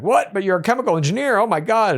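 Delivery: high, incredulous gasp of surprise; mock-stunned, voice jumping high; shocked disbelief. Contestant hearing he cheated in college, imitated high and shocked. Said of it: "And she's like".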